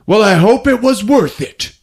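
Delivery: mock disapproving dad; stern father. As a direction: reproach her lightly. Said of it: "he's like".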